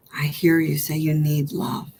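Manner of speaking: whispered comfort from a loving inner mother; tender whisper. Pretend. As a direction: soothe yourself in a hush. Mother self answers softly.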